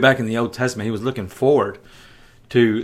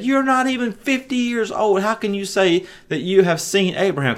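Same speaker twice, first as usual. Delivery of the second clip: mocking crowd; 7 semitones higher than usual. Crowd mocks Jesus.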